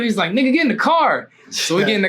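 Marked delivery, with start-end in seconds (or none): 0.3-1.3 higher-pitched impatient order; mock exasperated urging; retold urging. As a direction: imitate a friend urging you along. Friend urging him to go.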